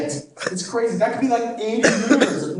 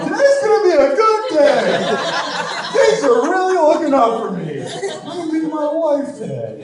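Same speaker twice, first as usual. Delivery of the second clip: high, loud and chipper, full of false hope; mock cheerful optimism; 9 semitones higher, 4 dB louder than usual. A man denied a burger at McDonald's, sarcastically sunny. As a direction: beam like the day can only get better. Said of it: "walks away ... going".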